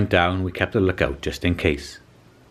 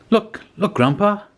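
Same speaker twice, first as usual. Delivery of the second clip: put-on much higher, urgent child's call; excited child; 13 semitones higher than usual. Child urging Grandpa to look at the catch, excited. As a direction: tug at Grandpa's sleeve, thrilled.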